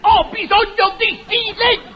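furious shout. Acted. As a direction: blow up at the pest. Doctor explodes at the pest. (il dottore urla furioso al seccatore)

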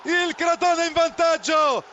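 high, building scramble call; breathless italian goal commentary. Real, not acted. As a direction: shout the goal, then repeat the score.

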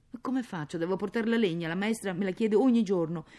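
anxious plea. Acted. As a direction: plead with mother, ashamed and worried. Child anxiously begs for wood. (supplica agitata di bambina)

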